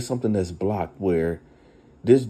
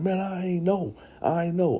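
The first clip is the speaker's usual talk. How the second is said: pleading; 11 semitones higher than usual. Retold or imitated. Friend pleads innocence in yard.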